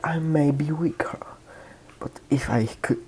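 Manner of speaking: whisper. Retold or imitated. Quiet aside in reply.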